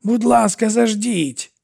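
high, urgent pleading; frightened plea. A narrator's reading. Irene begs Theo to wait, frightened. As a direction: beg him to stop, alarmed.

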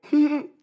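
high, loud hedgehog giggle; squeaky little laugh. Acted. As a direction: giggle like a tiny cartoon critter.

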